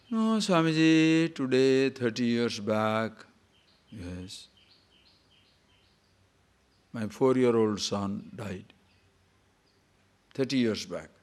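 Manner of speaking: slow, drawn-out mock lament sinking away; mildly acted crying woman; imitating tearful grief. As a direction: retell an old lady's grief, slow and falling. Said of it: "she's crying".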